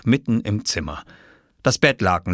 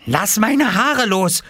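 high, slow, forceful cry; angry yell; 14 semitones higher, 4 dB louder, slower than usual. Acted. Jule with her hair grabbed by Oskar, angry yell. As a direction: shriek it at a hair-pulling brother. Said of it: "schrie Jule".

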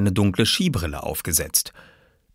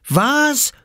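put-on high, loud child's shout; outraged yell; 5 dB louder than usual. Oskar jumping up from his chair at Jule's taunt, outraged yell. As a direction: yell it in shocked indignation. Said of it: "schrie Oskar und sprang".

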